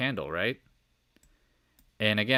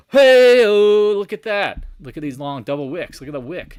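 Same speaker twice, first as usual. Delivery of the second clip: gasp, then loud high amazement; excited outburst; 10 semitones higher, 15 dB louder than usual. Retold or imitated. Retells his excited morning reaction.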